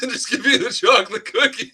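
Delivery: laughing through the words. Real, not acted. Co-host laughing at the Oreo joke while talking. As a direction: crack up as you finish the thought.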